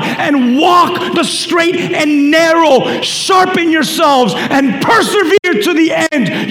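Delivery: high, driving pulpit exhortation; forceful preaching. Acted. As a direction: urge the congregation on, phrase by phrase.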